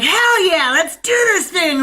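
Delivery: high, loud hyped-up cheer; rally the troops like a fired-up guy; pumped-up cheer. Retold or imitated. Imitating a man cheering on his crew, loud and hyped. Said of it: "It would be like".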